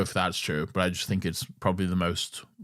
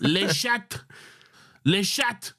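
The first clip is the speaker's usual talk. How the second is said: squeaky French; 11 semitones higher, much slower than usual. Pretend. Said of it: "mocking schoolboy French".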